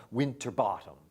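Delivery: Winterbottom with the stress shifted; alternative pronunciation. Shown as a demonstration. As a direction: try Winterbottom another way. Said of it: "pronounced Thomas Winterbottom".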